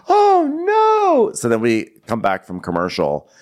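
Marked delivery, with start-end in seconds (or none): 0.1-1.3 much higher, louder drawn-out alarm; mock gasp of dismay. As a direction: cry it out like a shocked crowd. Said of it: "people are like".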